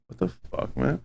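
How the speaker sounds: hushed whisper at the door; startled whispered curse. Retold or imitated. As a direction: whisper it so nobody outside hears. Reacting to knock at door.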